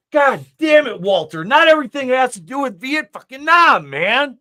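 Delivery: hammed-up movie rant; Big Lebowski rant. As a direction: yell it like the Dude losing it at Walter.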